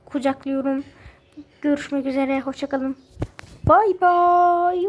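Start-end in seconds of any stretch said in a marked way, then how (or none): 3.6-4.9 louder put-on bye-bye; playful goodbye. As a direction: wave them off with a loud silly bye. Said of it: "playful goodbye voice".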